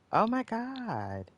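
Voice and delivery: high, drawn-out dismay; a slow, high cry of disbelief. Real, not acted. Player reacting to a weird game glitch, dismayed.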